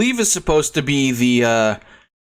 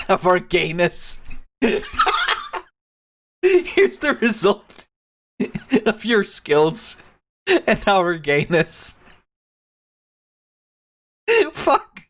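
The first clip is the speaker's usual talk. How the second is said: high-pitched laughing through words; 9 semitones higher, slower than usual. Real, not acted. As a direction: lose it laughing mid-line. Breaks up laughing.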